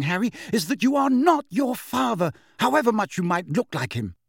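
high, emphatic telling-off; scolding. A narrator's reading. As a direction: set the child straight, pitched high. Molly scolds Harry in quarrel.

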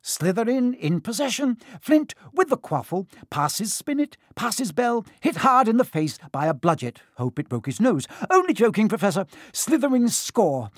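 high, excited match commentator; sports commentary. A narrator's reading. Lee calling the Slytherin match, excited commentary. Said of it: "Lee was still commentating".

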